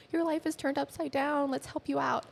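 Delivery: high-pitched voicing of concerned parents; high retold offer of help; imitated parents. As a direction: pitch it well up to quote a helpful parent.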